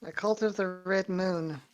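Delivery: ordinary talk, nothing marked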